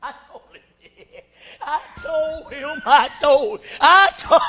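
gloating through laughter; Satan laughing. Acted. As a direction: cackle over your victory. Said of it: "Lucifer standing back laughing".

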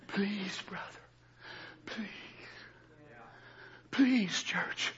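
soft whispered please. Real, not acted. Preacher pleading with his church, whispered. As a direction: barely breathe the plea.

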